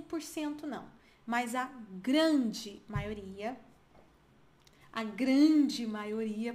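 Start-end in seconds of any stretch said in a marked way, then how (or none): 1.6-3.6 GRANDE dragged out very slowly; psychopath-like emphasis. Shown as a demonstration. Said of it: "parece psicopata". 4.9-6.5 drawn-out, half-speed GRANDE; psychopath-like emphasis. Shown as a demonstration. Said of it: "parece psicopata".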